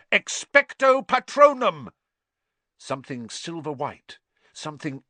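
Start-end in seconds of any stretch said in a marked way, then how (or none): 0.1-1.9 high, loud roaring incantation; roared spell. A narrator's reading. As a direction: bellow the spell with all your force.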